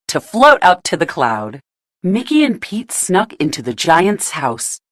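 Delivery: ordinary delivery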